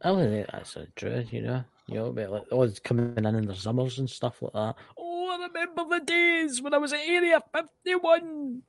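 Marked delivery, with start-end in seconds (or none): 5.0-8.6 put-on high, reedy old-timer voice; creaky old-timer voice. Old-timer reminiscing joke.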